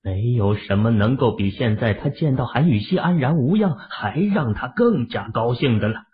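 plain reading voice

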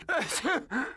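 dry sick cough. Acted. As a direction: cough weakly while ill. Clarence enfermo tose. (tos de enfermo)

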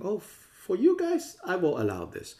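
a squeaky parody of a fickle Buddha; mock Buddha voice. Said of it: "Buddha is not saying".

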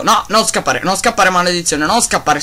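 yelling at the fleeing wolf in frustration; frustrated yelling. Real, not acted. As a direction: stop the runaway wolf. Wolf running away.

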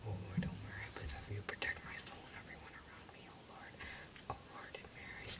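barely audible rushed whisper; whispered prayer. Pretend. Hider praying for protection, whispered. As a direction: whisper a hurried mock prayer.

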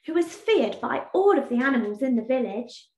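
usual speaking voice

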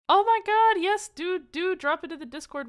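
high, loud burst, easing down; excited delight. Real, not acted. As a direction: burst out thrilled, then settle as you invite. Delighted invitation to a viewer.

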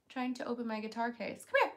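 normal talking voice

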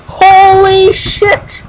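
high and slow, stretched "Holy shit!"; real awe, every syllable dragged out; drawn-out shock. Real, not acted. Repeated, drawn out.

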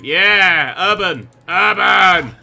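loud, drawn-out cheer; hyped shouting. Real, not acted. Player cheering his street beating, hyped shouting. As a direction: bellow it slowly, hyped up.

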